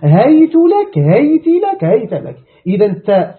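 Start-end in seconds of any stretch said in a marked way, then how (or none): 0.0-1.0 هيت لك said high and loud as "haytu lak"; "u" ending on هيت; damma on the ta. Shown as a demonstration. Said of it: "وقرئ بفتح التاء وكسرها وضمها". 1.0-1.8 هيت لك said high as "hayti lak"; "i" ending on هيت; kasra on the ta. Shown as a demonstration. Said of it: "وقرئ بفتح التاء وكسرها وضمها". 1.8-2.4 هيت لك said "hayta lak"; "a" ending on هيت; fatha on the ta. Shown as a demonstration. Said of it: "وقرئ بفتح التاء وكسرها وضمها".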